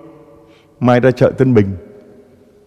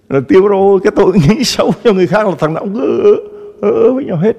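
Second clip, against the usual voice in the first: laughing mockery; 10 semitones higher, faster than usual. Mocking mute sinners grunting.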